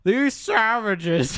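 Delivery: high, loud, slow villain impression; hammed-up scornful film quote; Parker Selfridge. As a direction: stretch it out like a movie villain. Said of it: "Parker Selfridge is his character's name in Avatar".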